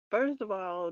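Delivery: usual speaking voice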